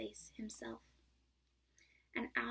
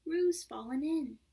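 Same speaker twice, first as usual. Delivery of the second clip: loud, higher alarmed cry; 5 semitones higher, 10 dB louder, slower than usual. A narrator's reading. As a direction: call out in alarm. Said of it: "cried Rabbit".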